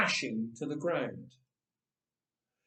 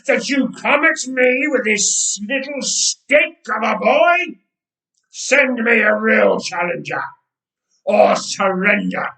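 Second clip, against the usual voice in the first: giant's roar; 6 semitones higher, 12 dB louder than usual. Acted. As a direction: roar it so the ground shakes. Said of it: "he roared".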